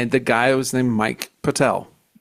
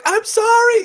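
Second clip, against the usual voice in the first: much higher, squeaky scared repeated apology; panicked apology; 4 dB louder than usual. Retold or imitated. Night desk guy begging his furious boss, high panicked apology. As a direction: yelp it out like a cornered kid.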